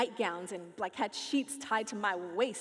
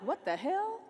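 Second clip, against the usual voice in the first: higher-pitched incredulous retort; mock disbelief; 8 semitones higher than usual. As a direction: play up the bafflement. Mock reaction to early risers.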